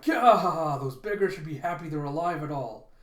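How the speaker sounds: loud sneering yell; contemptuous shout. Acted. A thug scorning the town's poor, loud sneering shout. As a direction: bellow the insult at the beggars.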